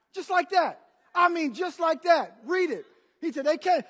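loud, high preacher's proclamation; ringing, emphatic sermon delivery; full-force preaching. Real, not acted. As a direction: drive it out to the whole congregation.

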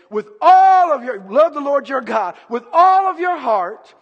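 loud, high, drawn-out preaching; impassioned, slow scriptural proclamation; passionate. Acted. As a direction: stretch and belt out each ALL. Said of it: "That's passion".

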